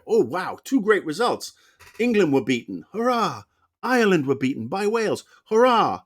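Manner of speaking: high, swooping mock cheer; acted delight. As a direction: celebrate two wins with hammed-up glee. Acts out delight at two results.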